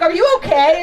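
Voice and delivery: mock a panicked boyfriend picking up; worried boyfriend. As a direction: boyfriend answering the call, hammed-up worried. Boyfriend answers the prank call.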